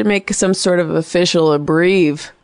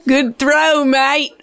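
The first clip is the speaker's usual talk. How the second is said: put-on playful Aussie accent, pitched higher; mock Australian accent; 7 semitones higher, much slower than usual. Radio host teaching a caller Australian terms, in a jokey Australian accent. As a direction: toss off a jokey Australian "good throw, mate". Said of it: "That's a good accent".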